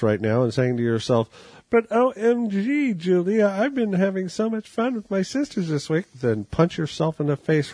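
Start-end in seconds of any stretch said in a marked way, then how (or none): 1.7-6.0 put-on sing-song valley girl whine; mock ditzy girl. Reader mocking a sorority sister's excuse in a high ditzy voice.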